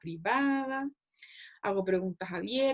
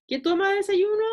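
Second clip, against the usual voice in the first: high, louder questioning voice; interviewer asking; 9 semitones higher, 5 dB louder than usual. Shown as a demonstration. Nutritionist as interviewer probing breakfast, high and louder. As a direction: ask it up high and clear.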